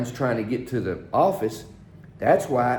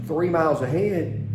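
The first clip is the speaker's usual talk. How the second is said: normal talking voice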